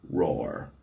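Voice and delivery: very low, flatly spoken roar; deadpan monster "roar". A narrator's reading. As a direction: say the word roar, deep and deadpan. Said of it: "It simply said the word".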